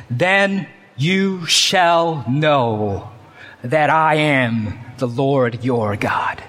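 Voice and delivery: drawn out slowly, dropping to a whisper on the last words; slow hushed emphasis. Shown as a demonstration. Preacher reading God's promise to his congregation, slow and whispered. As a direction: let it land slowly, then whisper the name of the Lord. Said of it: "And get this".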